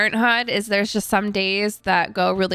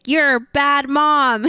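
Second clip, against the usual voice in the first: mocking websites; 6 semitones higher, 4 dB louder, slower than usual. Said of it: "All the websites online are like".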